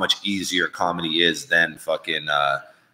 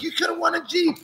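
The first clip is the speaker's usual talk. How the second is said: much higher, squealing panic; mock freaked-out bartender. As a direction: imitate the frantic bartender, pitched way up. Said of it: "She's like".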